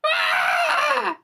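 very high, sustained shrill shriek; shrill creature scream. Acted. Game master voices the creature heard from the elevator, shrill scream. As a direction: screech like a creature, high and piercing. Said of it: "in this sort of shrill voice going".

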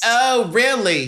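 high eager son answering mom. Pretend. Son answering his mother.

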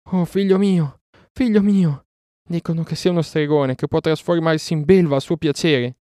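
high, loud anguished plea; crying-out mother. A narrator's reading. Mesua running to her son, crying out. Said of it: "gridò".

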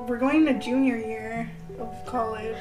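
usual speaking voice

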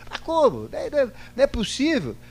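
high, disbelieving cry of a shocked pastor; incredulous pastor. Retold or imitated. Retelling the pastor's shocked reply, high and incredulous. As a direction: imitate the pastor, aghast at the claim.

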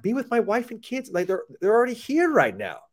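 high exasperated self-quote; voicing his own thoughts, pitched high. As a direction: play it as your own frustrated inner voice, high. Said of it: "And I'm like".

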